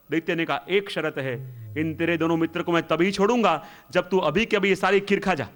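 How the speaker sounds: ordinary delivery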